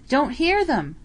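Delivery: loud, high yell back. Acted. Tom yelling back to Bill in the trench. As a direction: shout the reply over gunfire. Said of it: "Tom yelled back".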